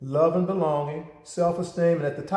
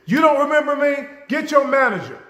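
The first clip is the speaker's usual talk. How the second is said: much higher and louder, affronted; outraged customer; 10 semitones higher, 6 dB louder than usual. Retold or imitated. Customer to cashier, outraged and loud. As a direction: demand the manager with outrage. Customer confronting counter staff.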